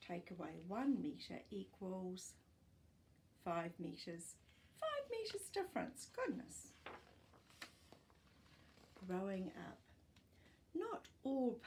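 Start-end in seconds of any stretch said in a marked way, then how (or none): 4.8-6.7 amazed. Real, not acted. Reader marvels at size gap.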